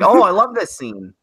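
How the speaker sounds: high, gushing fan voice; mock moviegoer gush. Mocking a chatty moviegoer, gushing high. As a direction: gush over the scene like an annoying viewer. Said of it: "It's like".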